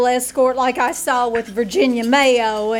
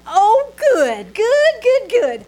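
high, gushing delight; thrilled; 10 semitones higher, slower than usual. Acted. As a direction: squeal with joy over the food. Thrilled about seafood.

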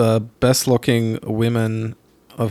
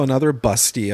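usual speaking voice

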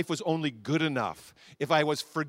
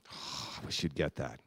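sighing quiet aside; 13 semitones lower, 10 dB quieter than usual. Real, not acted. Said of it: "sighs, wishes they'd understand".

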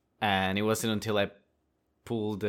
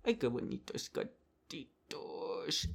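whispered coo; 5 semitones higher, 7 dB quieter, slower than usual. Real, not acted. Cooing to the kittens.

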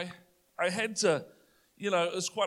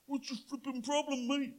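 high, challenging bully voice; retold pushy teenager picking a fight; aggressive stranger; 7 semitones higher, 3 dB quieter than usual. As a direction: square up to the kid. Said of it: "he's like".

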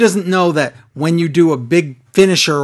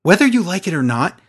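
ordinary talk, nothing marked

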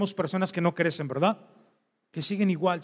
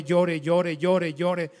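usual speaking voice

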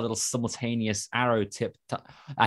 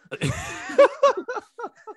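squealing giggle fit; high-pitched giggle; 6 dB louder than usual. Real, not acted. Breaks into giggles after leaving.

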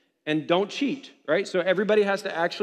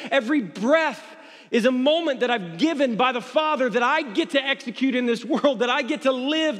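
preaching at full force; 7 semitones higher, 4 dB louder than usual. Acted. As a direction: preach it hard, pounding every line.

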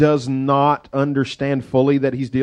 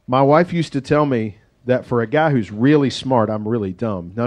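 ordinary delivery

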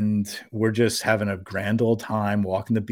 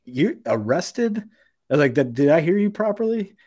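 higher, disbelieving questions; retold incredulity, pitched up; incredulous; 7 semitones higher than usual. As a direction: act out stunned disbelief. Retelling his disbelief at the threat.

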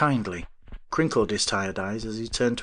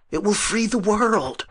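fervent; 12 semitones higher, 4 dB louder than usual. A narrator's reading. Visionary arguing with professor.

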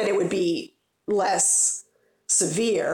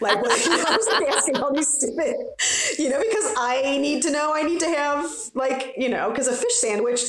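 laughing while talking, higher; 7 semitones higher than usual. Real, not acted. Mortician mocking her own fish-sandwich questions, laughing. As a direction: giggle through the words at yourself.

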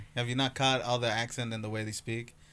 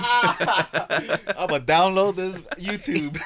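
slow, laughing through the words; giggling while saying it; 8 semitones higher, 3 dB louder, much slower than usual. Real, not acted. Joking, laughing.

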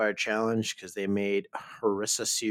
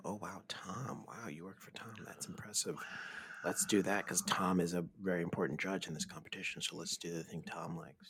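whispered, softer, fake impressed teammates; mock awed whisper; 5 semitones lower, 14 dB quieter than usual. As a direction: hush it like a sarcastic secret. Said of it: "They're like".

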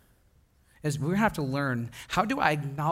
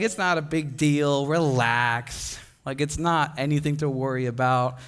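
slow, unbothered mock reassurance; Nick; 3 semitones lower, slower than usual. Back-seat friend brushing off the car's panic, mock laid-back. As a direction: play the chilled-out friend, dropping lower on "relax". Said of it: "he's in the back going".

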